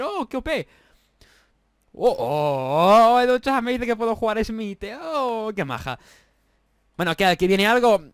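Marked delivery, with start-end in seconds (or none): none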